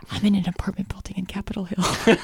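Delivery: playful hushed whisper. Pretend. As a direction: whisper it like a joking hostage. Pretend plea for rescue.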